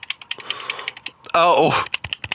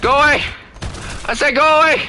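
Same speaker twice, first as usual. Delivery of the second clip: shouting; 13 semitones higher, faster than usual. Real, not acted. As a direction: scream at them to back off. Shouting at chasing enemy planes.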